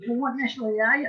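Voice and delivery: quizzing stranger. Retold or imitated. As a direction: briskly play the nosy asker. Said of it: "when people sort of say to me".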